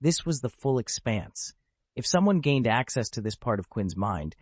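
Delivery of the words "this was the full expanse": ordinary delivery, nothing marked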